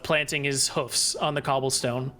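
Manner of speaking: normal talking voice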